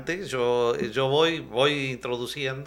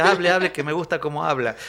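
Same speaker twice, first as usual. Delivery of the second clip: louder, pleading hable hable; eager pleading fan; 4 semitones higher, 9 dB louder than usual. Retold or imitated. A pastor voicing eager fans who love his Argentine accent, loud pleading. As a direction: beg him to keep talking, louder. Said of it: "Voy a diversos países".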